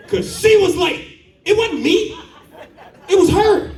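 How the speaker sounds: high, defensive squeak. Real, not acted. Comedian blaming his wife for the late pregnancy, high. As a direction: point the finger, pitch way up.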